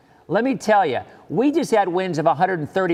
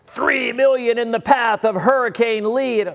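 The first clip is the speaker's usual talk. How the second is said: mock alarm; 7 semitones higher, 6 dB louder than usual. Quoting hype headlines.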